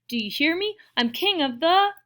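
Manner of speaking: loud, high character boast; snarling boast. A narrator's reading. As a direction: crow over the crowd, cut off mid-sentence. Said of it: "he snarled".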